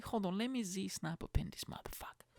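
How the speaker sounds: whispered aside; hushed quick aside. Real, not acted. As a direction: whisper it off-mic. Aside while snapping.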